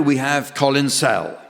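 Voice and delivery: ordinary talk, nothing marked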